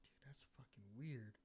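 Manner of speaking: hushed and spooked; retold creeped-out murmur, under the breath; uneasy whisper. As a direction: whisper it to yourself. Nobody outside the silent room.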